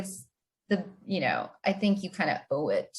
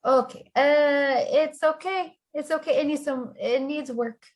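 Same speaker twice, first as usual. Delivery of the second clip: higher, strained reassurance; pitched-up polite reassurance; awkward encouragement; 6 semitones higher than usual. Acted. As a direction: cover disappointment with a bright lift. Awkward reaction to bad letter.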